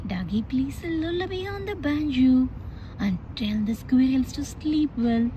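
plain reading voice

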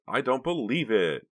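high, loud and slow disbelief; can't believe what she sees; shocked disbelief. A narrator's reading. Karina to Vincent, loud stunned disbelief.

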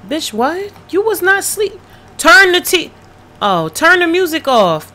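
fed-up shout at the game; exasperated yelling. Real, not acted. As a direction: chew out the careless sim. Yelling at sim who woke.

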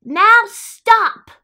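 high, very loud halt order; commanding shout. Acted. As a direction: shout to stop a wild party. Max orders the monsters to stop.

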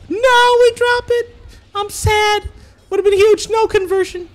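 high-pitched wail of dismay; dismayed high cry. Real, not acted. Commentator reacting to a dropped combo, high dismayed cry. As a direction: cry out high over a blown chance.

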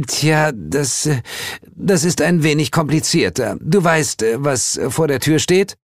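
high-pitched female character, hedging; high, halting figure voice; Heel's hesitant explanation. Acted. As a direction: feel your way into an awkward request. Heel explains her problem.

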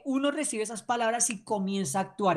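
usual speaking voice

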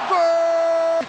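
goal shout. Real, not acted. Goal call.

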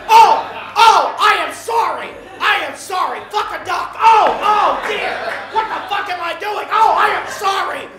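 loud, high, overdone gasping apology for a slip; mock horrified apology. Swearing on morning TV, apologizes in a loud mock panic. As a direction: ham up the shocked oh and the sorry.

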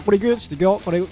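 normal talking voice

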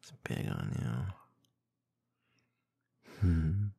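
usual speaking voice

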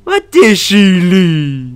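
high, loud, drawn-out cooing; stretched-out squeals of affection; adoring gush. Real, not acted. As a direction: gush slowly over an adorable dog. Gushing while petting the dog.

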